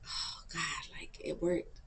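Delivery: whispered, retold realization; barely voiced, awed relief; hushed relief. Waking up and finding the prayer worked, hushed relief.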